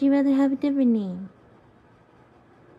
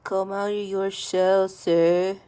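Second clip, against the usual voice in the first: mock stern voice; 8 semitones lower, 4 dB louder, slower than usual. Real, not acted. Mock formal coaxing.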